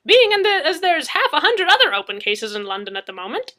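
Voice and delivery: high, loud needling retort; Madzeke's voice. Acted. Madzeke presses Murphy, high and loud.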